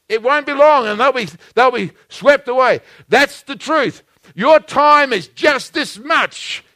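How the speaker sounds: loud, high, fervent sermon delivery; pulpit-pounding preaching voice; full-force preaching. Acted. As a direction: preach it out big to the congregation.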